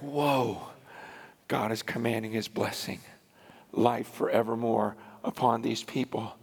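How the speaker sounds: soft low wonder; awed murmur; hushed awe. Retold or imitated. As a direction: breathe it out in quiet amazement. Awe at people coming forward.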